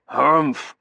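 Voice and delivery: put-on blustering old colonel's snort; disdainful colonel. A narrator's reading. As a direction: harrumph loudly with contempt.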